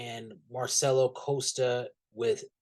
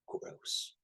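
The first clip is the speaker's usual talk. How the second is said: whispered disgust; 14 dB quieter than usual. Real, not acted. Disgusted aside on the characters.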